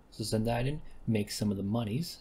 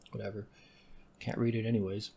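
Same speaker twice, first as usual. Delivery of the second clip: normal talking voice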